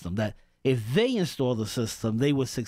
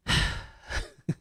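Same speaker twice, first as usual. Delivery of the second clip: long, voiceless sigh of disbelief; exasperated sigh; 3 dB quieter than usual. Real, not acted. Host reacting to the May Day news, exasperated sigh. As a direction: let out a heavy, exasperated breath.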